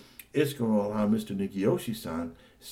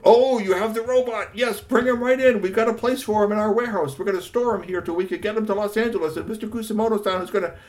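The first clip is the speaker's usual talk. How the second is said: high, louder eager uncle voice; excited uncle; 12 semitones higher, 7 dB louder than usual. Acted. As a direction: talk fast and eager like the uncle.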